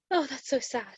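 a hammed-up gasp, then a sympathetic sigh; imitating the sympathetic dentist. Retelling the dentist pitying her birthday, soft mock sympathy. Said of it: "She was like".

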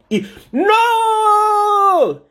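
long, high, loud wail of NO; horrified scream. Acted. Michael watching Eve about to bite, horrified scream. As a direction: scream it out as disaster strikes.

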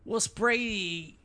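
slow, high Boston drawl on Brady; Boston accent. Shown as a demonstration. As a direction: stretch Brady out like a Boston local. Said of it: "How do you say Brady".

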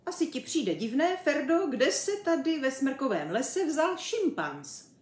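high, lively chimp voice; bouncy, talkative animal; chatty chimp. A narrator's reading. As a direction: prompt the dog with eager curiosity. Chimp character addresses Ferda.

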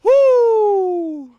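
high, loud joyful whoop; excited whoop. Real, not acted. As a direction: whoop like a fan. Fan celebrating Derek Rose.

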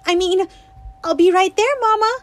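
high, loud, hasty polite fix; quick correction. Acted. Winnie correcting herself to her mother, hasty and high. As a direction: quickly take it back sweetly. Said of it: "and then added quickly".

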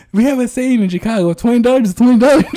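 high laughing mock proverb. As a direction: announce it laughing loudly. Joking Chicago saying.

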